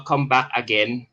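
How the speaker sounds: usual speaking voice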